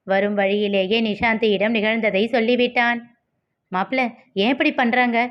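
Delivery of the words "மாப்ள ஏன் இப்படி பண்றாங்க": ordinary delivery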